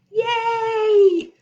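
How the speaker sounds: high, drawn-out squeal of welcome; excited cheer. Real, not acted. Streamer greeting Irma's arrival with her pet, excited high cheer.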